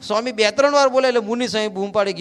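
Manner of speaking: ordinary talk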